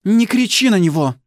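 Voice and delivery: high, loud, icy order; mother icily defending her son; icy command. Acted. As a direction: cut him off coldly in a high voice. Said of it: "говорит она ледяным голосом".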